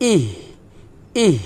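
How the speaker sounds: ইহ with a soft release of breath; ইহ closing on a gentle glottal h; light airy h. Shown as a demonstration. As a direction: let a little air out at the end of ইহ. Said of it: "এভাবে".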